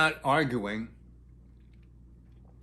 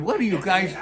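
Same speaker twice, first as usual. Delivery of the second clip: raised, higher-pitched retold question; incredulous exclamation; 4 semitones higher, 5 dB louder than usual. Snowplow driver questioning stranded pair, loud and incredulous. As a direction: demand it in disbelief.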